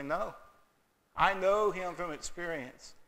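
usual speaking voice

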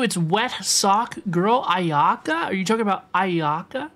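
normal talking voice

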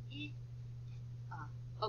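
"I" short and "uh", said softly; teacher showing the short vowels softly; soft vowel sounds. Shown as a demonstration. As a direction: quietly model the short "I" and "uh". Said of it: "We want the soft sound".

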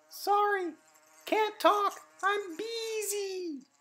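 a frantic bee with no time; busy bee voice. Acted. The bee too busy to talk to Simon, very high.

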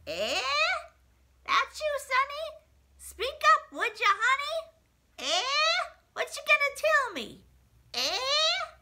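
put-on high, loud old granny who can't hear; a boy at the door, his deaf granny answers loudly and high. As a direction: shout like an old lady hard of hearing. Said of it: "sees him and says".

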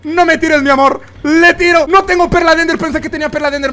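high, loud panicky rush; frantic excitement. Real, not acted. Gamer begging not to be knocked off, frantic. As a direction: plead and scramble at full volume. Pleading in a tense fight.

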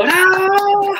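high, loud, slow bravo; cheering bravo. Retold or imitated. Comedian mimicking the crowd's applause, high and loud.

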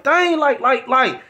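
high, loud, exasperated scolding; retold screaming. Woman scolding her man about cooking, retold screaming. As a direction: imitate her yelling at him. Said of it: "she's screaming".

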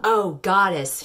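slow drawn-out "Oh, goddess" moaned; mock moan. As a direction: stretch "Oh" in a hammed-up moan. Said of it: "she moaned".

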